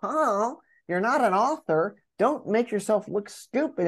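a hint of a sneering inner voice; imposter voice. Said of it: "the imposter is kind of like".

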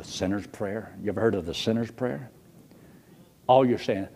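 normal talking voice

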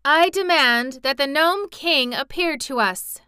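high, loud, imperious summons; loud cry. A narrator's reading. Ozma summoning the unseen Gnome King, loud and commanding. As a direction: call out a royal demand. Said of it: "cried out in a loud voice".

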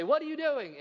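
high call toward the graves. Retold or imitated. Grandchildren call out to the graves, high. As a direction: sing out to the graves like a child.

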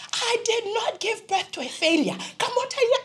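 high-pitched, forceful motherly protest; indignant mother. Retold or imitated. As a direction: voice a mother fiercely refusing to hear it. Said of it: "it was shouting at you with love".

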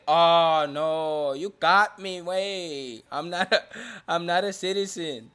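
hammed-up, loud fake dismay; mock confession. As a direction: sarcastically act out being caught. Mock confession by imagined liar.

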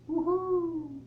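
half-hearted high-pitched celebration; mock cheer. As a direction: cheer it high without shouting. Mock cheer at forgiven sins.

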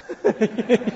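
light amused chuckle; a short warm laugh at the joke; real laugh. Real, not acted. Laughs at own story.

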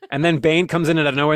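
ordinary delivery

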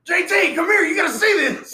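loud excited call across the house; calling across the house to come look. Retold or imitated. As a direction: shout for him to come see.

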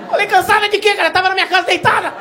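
high, loud disbelief; incredulous. Retold or imitated. To his girlfriend claiming to be tired, loud high disbelief. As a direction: sound outraged, high and loud.

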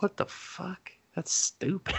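high, whispery, incredulous aside; hushed disbelief. Retold or imitated. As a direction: drop to a stunned near-whisper. Teller's hushed reaction to kid's plan.

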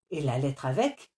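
plain reading voice

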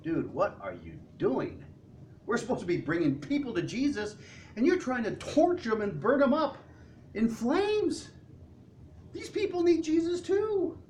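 alarmed friend, voice climbing; Andrew pleading. Retold or imitated. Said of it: "Can you see Andrew sitting there going".

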